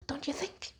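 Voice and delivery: a hushed, pointed little jab; sarcastic. Real, not acted. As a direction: whisper the question knowingly. Repeats sarcastic question softer.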